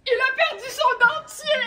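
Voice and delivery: high squealing laugh through the words. Acted. A storyteller laughing high at the lost dentures. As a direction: burst out laughing as you tell it.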